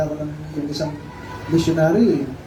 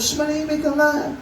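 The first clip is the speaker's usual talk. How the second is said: high-pitched hushed scolding; acted bystander's shush; 8 semitones higher than usual. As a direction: hush the beggar crossly. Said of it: "Talagang nung sabi".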